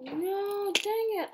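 high, loud annoyed outburst; frustrated cry. Real, not acted. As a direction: yelp in annoyance. Failing in game.

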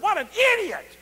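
high, loud mock cursing; boy cursing his mother on the way to church; angry cussing. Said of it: "I cussed my mother".

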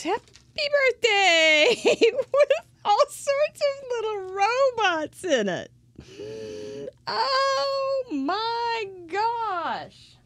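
high, loud, stretched squeal of delight. Real, not acted. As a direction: squeal it like the card just surprised you. Surprise card pops out.

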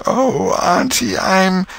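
high, slow, put-on suffering. Tom moaning to fool his aunt, slow and pitiful.

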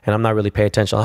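ordinary talk, nothing marked

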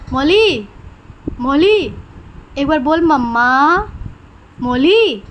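loud repeated calls of the name; calling out. Real, not acted. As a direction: keep calling the bird to answer. Calling the bird to say mamma. (चिड़िया को बार बार पुकारना)

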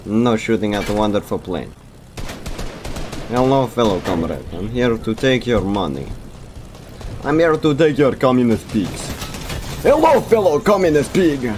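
Russian comrade voice, getting sillier; mock Russian accent. Pilot greeting enemy players, mock Russian accent. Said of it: "the Soviet accent".

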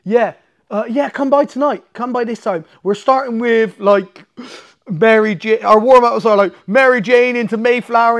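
high, loud, rushed enthusiastic instructor; mock eager coach. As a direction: rattle off the warm-ups brightly like an eager coach. Imitating Eddie's excited instructions.

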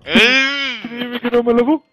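taunting. Pretend. Repeated taunt, higher.

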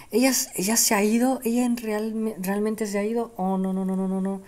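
high, distraught lament; grieving. Acted. Witness learning her manager died, wailing.

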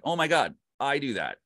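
high, amazed realisation; surprised recognition. Retold or imitated. To a fellow narrator, recalling Serkis, amazed recognition. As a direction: gasp as if caught doing it yourself. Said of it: "I was like".

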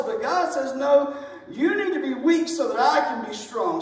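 loud, high, driving sermon delivery; preaching at full force. Acted. Preacher to his congregation on grace, preaching loud and high.

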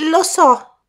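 a worried youth bursting in; young man Colin. Acted.

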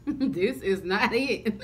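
slow, laughing through the words. Real, not acted. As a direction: laugh it out slowly. Laughs at the bad food.

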